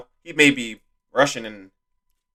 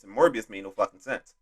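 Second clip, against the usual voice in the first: usual speaking voice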